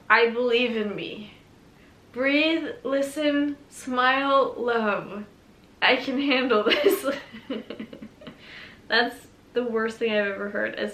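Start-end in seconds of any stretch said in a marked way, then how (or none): 0.1-7.1 loud, hammed-up affirmation reading; mock inspirational. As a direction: read cheesy affirmations over the top. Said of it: "these are so cheesy".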